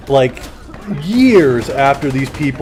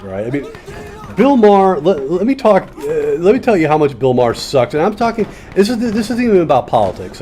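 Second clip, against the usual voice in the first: normal talking voice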